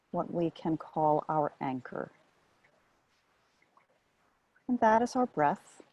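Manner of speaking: calm, measured meditation guidance with long pauses; guided meditation. Real, not acted. Meditation teacher settling listeners onto the breath as anchor, guided meditation. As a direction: ease them into stillness, one short phrase at a time.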